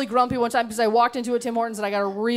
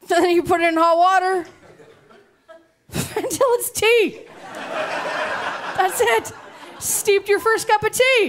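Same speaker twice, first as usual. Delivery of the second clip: mock triumph; 8 semitones higher than usual. Mocking Tim Hortons tea.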